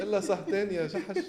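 high mock Peter voice, laughing; imitating Peter. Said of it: "طلعت بيتر هيك".

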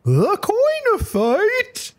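very high, drawn-out mock Chinese accent; exaggerated high-pitched attempt at a Chinese accent; supposed Chinese accent. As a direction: squeal it slowly in a dubious put-on Chinese accent. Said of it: "Was that a racist Chinese accent".

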